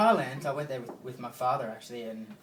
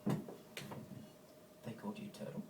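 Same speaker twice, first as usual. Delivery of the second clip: whispered amazement; 2 semitones lower, 11 dB quieter, much slower than usual. Retold or imitated. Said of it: "Girls are always like".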